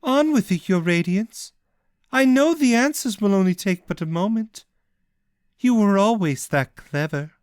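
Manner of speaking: calm, cold taunt; patient and cold. Acted. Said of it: "smiled, patient and cold".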